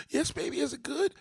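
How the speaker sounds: high-pitched, mock-sultry questioning.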